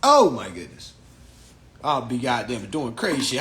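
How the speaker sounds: usual speaking voice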